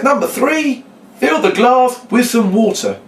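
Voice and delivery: high, loud, drawn-out reading of an instruction; elaborated announcement. Shown as a demonstration. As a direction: announce it grandly, stretching the words. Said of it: "I'm kind of elaborating it".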